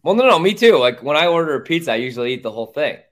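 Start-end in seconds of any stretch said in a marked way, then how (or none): none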